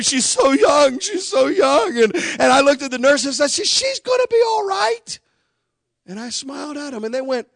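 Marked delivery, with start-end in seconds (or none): 0.0-2.0 high, pitying wail of grieving nurses; crying nurses. Retold or imitated. Nurses grieving over the young wife, high tearful lament. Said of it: "nurses started crying". 3.7-5.2 much higher, ringing declaration of faith; confident insistence. Retold or imitated. Husband assuring weeping nurses, high and ringing.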